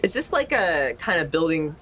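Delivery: ordinary delivery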